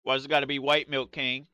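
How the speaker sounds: ordinary delivery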